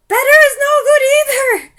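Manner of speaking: put-on very high, very loud accented shriek; shrieking witch. Grand High Witch shrieking back at murmuring witches. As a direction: shriek it with a wild edge. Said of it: "shrieked the Grand High Witch".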